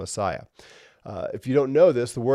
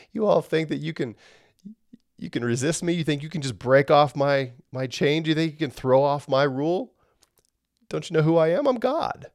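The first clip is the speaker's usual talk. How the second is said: God scoffing at rebels, raised and mocking; 5 semitones higher than usual. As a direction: taunt them as an amused God. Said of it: "God says".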